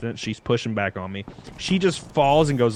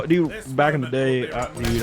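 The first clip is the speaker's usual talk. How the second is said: normal talking voice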